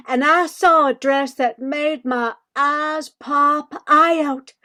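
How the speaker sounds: usual speaking voice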